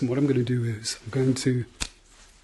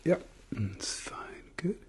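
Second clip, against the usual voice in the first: whispering; 5 semitones higher, 10 dB quieter, slower than usual. Acted. ASMR doctor exam roleplay.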